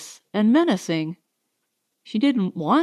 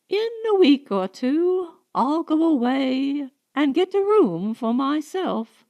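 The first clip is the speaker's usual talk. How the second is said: inner thought; 5 semitones higher than usual. A narrator's reading. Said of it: "she thought".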